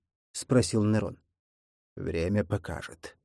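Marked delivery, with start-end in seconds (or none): 2.0-3.2 soft, slow, lower rustling voice; rustling whisper. Acted. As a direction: rustle it out quietly. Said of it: "прошуршал Водяной".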